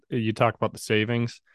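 normal talking voice

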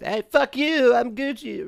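high, mocking fake voice; mocking a rude guy. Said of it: "And he's".

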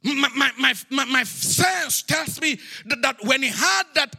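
emphatic, ringing sermon delivery; full-force preaching. Real, not acted. Preacher telling of a needed blessing, full-force preaching. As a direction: drive each phrase home to the congregation.

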